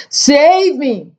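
high scream for help. A narrator's reading. Giant in a nightmare calling for rescue, screaming. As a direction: scream it out, pitched well up. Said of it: "screamed the flesh lump eater".

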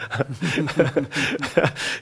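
amused chuckle after an aside; short run of easy laughter. Real, not acted. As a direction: laugh lightly at your own remark. Teasing aside about Samuel present.

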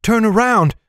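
high urgent warning cry. A narrator's reading. Heroine warning companions at dead end, high cry. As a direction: call it out high and sudden. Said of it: "cried Lauren".

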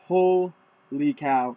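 drawn-out, slow "holy cow"; long, lingering exclamation of amazement; retold amazement. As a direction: stretch it out in mock awe. Retold amazement on finding racks.

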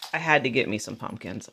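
ordinary talk

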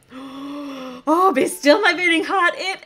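gasp, then high, gushing excitement; thrilled surprise. Real, not acted. Toy reviewer discovering a chocolate fountain, thrilled surprise. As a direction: build from a swooning gasp to a high, delighted cry.

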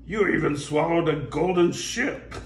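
pirate line. Acted. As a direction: begun in the wrong character. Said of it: "oh, said".

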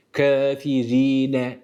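Kafirine very slow, ri with a pure i; drawn-out Kafirine, pure ri; clear i on ra. Shown as a demonstration. Tutor models the kesra on ra. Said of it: "Ra kesreli olduğu zaman İ sesiyle".

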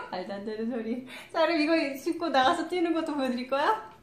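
usual speaking voice

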